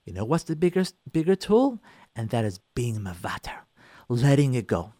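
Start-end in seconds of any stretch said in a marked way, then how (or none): 2.1-4.9 hushed, unvoiced whisper of the key idea. Real, not acted. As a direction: lean in and whisper it. Whispered key point in talk.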